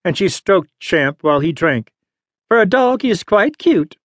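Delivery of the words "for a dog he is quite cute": put-on high, softened old lady. A narrator's reading. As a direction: soften like a grudging old woman. Old lady softening toward dog.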